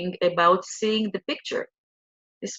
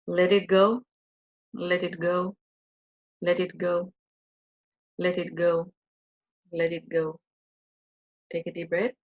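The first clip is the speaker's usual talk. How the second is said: soft, repeated let it go, fading quieter; gentle guided-meditation chant; soothing guided release; 5 dB quieter than usual. Acted.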